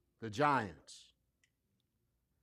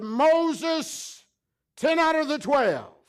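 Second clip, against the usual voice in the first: spies shouting report; 12 semitones higher, 11 dB louder, slower than usual. Retold or imitated.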